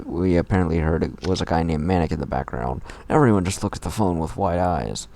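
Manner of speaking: ordinary talk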